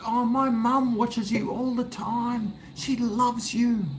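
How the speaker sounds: put-on high girly voice, slowing; girl's voice. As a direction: imitate the excited young fan, high. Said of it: "She goes".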